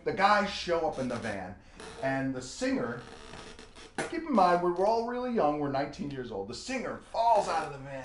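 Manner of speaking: normal talking voice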